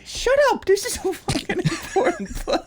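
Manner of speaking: very high-pitched strained yell; hammed-up frantic anger; mock fan outburst. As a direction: re-enact silencing the room during a play. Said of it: "It really does make you nuts".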